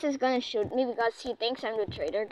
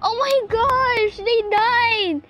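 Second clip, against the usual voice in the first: high, loud exclaiming; hammed-up high-pitched shock; mock shock; 8 semitones higher, 5 dB louder than usual. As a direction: wail it in playful disbelief. Exclaiming over game deaths.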